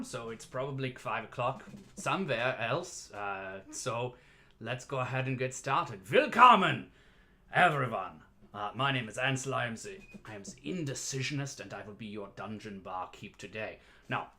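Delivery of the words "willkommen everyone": big, ringing opening call; calling out welcome. Pretend. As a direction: belt out a grand welcome to the audience. Host calls out opening welcome.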